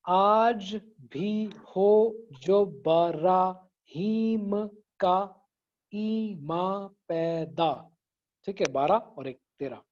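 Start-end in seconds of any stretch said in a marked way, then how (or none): 0.1-8.0 slow recitation split into syllables; slow syllable count. Shown as a demonstration. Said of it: "people who have joined just now". (शेर को धीरे धीरे, syllable गिनते हुए पढ़ना)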